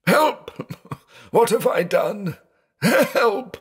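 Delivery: very high, strained cries of terror; panicked cries. A narrator's reading. Blind man after the plate breaks, panicked cries. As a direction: cry out in blind panic, pitch leaping up. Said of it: "the finishing touch to his panic".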